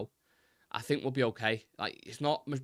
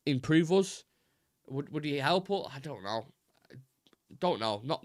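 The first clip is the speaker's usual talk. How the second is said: ordinary delivery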